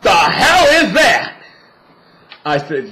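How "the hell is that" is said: high, loud mock-startled father; startled dad. Drag queen imitating her father shocked by a drag queen on TV, high and loud. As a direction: blurt it out like a shocked burly dad. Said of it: "He's like".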